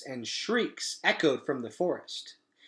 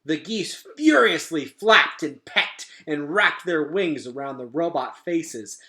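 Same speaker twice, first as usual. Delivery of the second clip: plain reading voice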